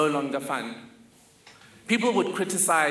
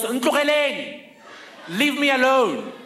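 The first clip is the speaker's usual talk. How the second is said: high, loud yell with a laugh underneath; 8 semitones higher, 6 dB louder than usual. Retold or imitated. Mother at the window yelling at the car, laughing. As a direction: shout them off, half laughing. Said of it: "and yells".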